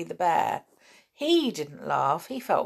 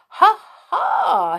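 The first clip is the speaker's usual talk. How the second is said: high, loud triumphant "ha, ha"; gloating; 12 semitones higher, 8 dB louder than usual. A narrator's reading. Bear who outdid the mouse, gloating. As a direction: crow with glee.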